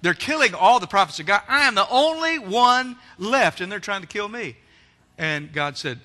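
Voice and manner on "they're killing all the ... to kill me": loud, high, rising lament; anguished complaint. Acted. Elijah complaining to God in the cave, loud lament. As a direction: pour out the complaint, building.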